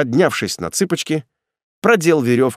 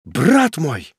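higher, astonished exclamation; amazed cry; 4 semitones higher than usual. A narrator's reading. Executioner recognising his brother, amazed cry. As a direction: exclaim as you recognise him. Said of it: "вскрикнул от изумления".

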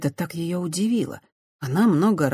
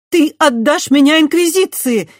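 much higher and louder, shocked; disbelieving cry; 12 semitones higher, 9 dB louder than usual. A narrator's reading. Christiana crying out in disbelief at the betrayal. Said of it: "неверяще воскликнула Христиана".

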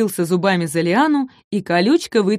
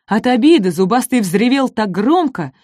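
ordinary delivery

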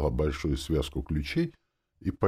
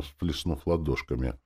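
ordinary delivery

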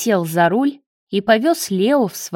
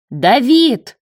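higher, louder yell of alarm; frantic yell; 5 semitones higher, 6 dB louder than usual. A narrator's reading. Marat yelling for David in alarm, frantic. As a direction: yell out for help. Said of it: "заорал он истошно".

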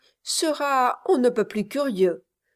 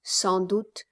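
usual speaking voice

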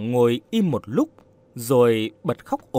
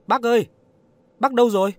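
put-on high, raised call for the old man; calling out loudly; 9 semitones higher, 4 dB louder, much faster than usual. A narrator's reading. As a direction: call out searching for someone out of sight. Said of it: "Cô lớn tiếng gọi".

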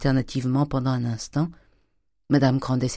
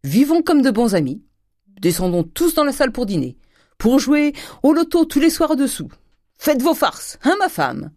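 high, loud, jovial invitation; Grandet, exuberant; 12 semitones higher, 5 dB louder than usual. A narrator's reading. Husband proposing friendship to his family, exuberant joviality. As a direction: pile up plans with climbing cheer.

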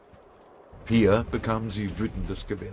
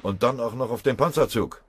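loud, raised reporting voice; brisk military report; 7 semitones higher, 6 dB louder than usual. A narrator's reading. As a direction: deliver it as a loud, factual report. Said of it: "berichtete er kurz und sachlich". (laute, sachliche militärische Meldung)

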